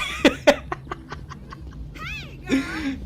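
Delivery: real high-pitched burst of laughter. Real, not acted. Storyteller laughing at the drama, high laugh. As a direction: crack up at the story. Laughing at the scene she retells.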